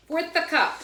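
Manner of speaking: Italian accent impression of a grandmother. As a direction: belt "Fourth a cup" like an Italian grandmother. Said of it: "with her Italian accent".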